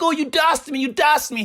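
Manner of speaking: whiny accusing voice; a light mocking whine; mock scared kid. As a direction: act out the scared kid complaining. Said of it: "He was like".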